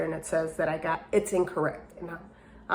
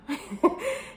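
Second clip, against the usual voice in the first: short high giggle of disbelief; 11 semitones higher than usual. Real, not acted. Can't believe she's free to leave, high giggle. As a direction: let a giggle slip out.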